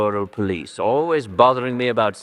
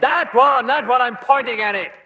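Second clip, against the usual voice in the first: much higher and louder, losing patience; exasperated shout; 4 dB louder than usual. Acted. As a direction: bellow it as if to an idiot.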